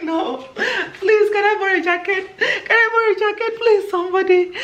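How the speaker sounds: panicked laughing plea for a jacket; frantic begging. Retold or imitated. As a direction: beg anyone desperately.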